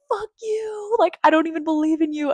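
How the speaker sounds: high cry of defiance; mock furious. Cursing God in a hard place, high defiant cry. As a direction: hurl it up at God.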